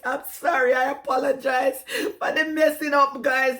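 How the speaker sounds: ordinary talk, nothing marked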